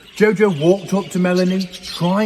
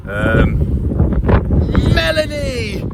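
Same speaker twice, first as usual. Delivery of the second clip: high, bright call of a name; a child calling her friend eagerly; excited shout; 7 semitones higher, 5 dB louder, slower than usual. A narrator's reading. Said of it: "shouted Jojo excitedly".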